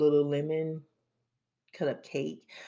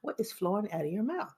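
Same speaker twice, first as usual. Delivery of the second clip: inner voice scolding; 8 semitones higher, 5 dB quieter than usual. Retold or imitated. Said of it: "My brain is like".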